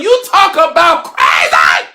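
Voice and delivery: loud, slowed-down exasperated shout; drawn-out angry outburst at the viewers; frustrated yell. Real, not acted. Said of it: "Sorry for yelling".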